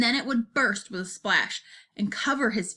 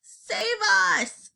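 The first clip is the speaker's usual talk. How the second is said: high, loud scream for help; panicked high-pitched cry; screamed plea; 11 semitones higher, 4 dB louder than usual. A narrator's reading. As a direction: scream it out like a terrified giant. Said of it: "screamed the flesh lump eater".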